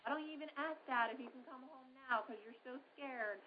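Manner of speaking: imitated sugary reassurance; rescuing parent. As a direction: play the parent swooping in to comfort. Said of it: "swoop in and say".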